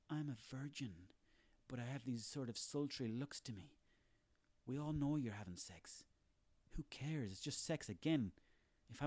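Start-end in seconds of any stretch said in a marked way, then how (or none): 0.1-3.8 soft, breathy-free coy voice, much softer; a put-on innocent girl, hushed and coy; mock innocent pop star. Mocking the pop star, a soft coy innocent voice. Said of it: "where it's like".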